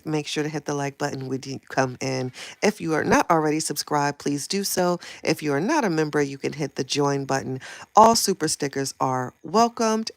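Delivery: quiet, practised host spiel; scripted channel sign-off. Shown as a demonstration. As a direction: recite the outro softly and smoothly. Recites her channel sign-off.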